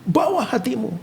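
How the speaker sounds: high-pitched imitated church exhortation; worship leader. Worship leader addressing the congregation at Christmas, exhorting. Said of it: "worship leader itu sama pendeta ngomongnya sama".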